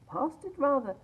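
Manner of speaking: a son answering his mother simply; boy's voice. A narrator's reading. Peter replying to his mother about father, boyish.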